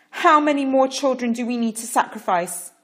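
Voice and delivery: loud, high demand. A narrator's reading. Parrot confronting Pharaoh, loud demanding cry. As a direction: demand it of the king, full voice. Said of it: "Parrot demanded".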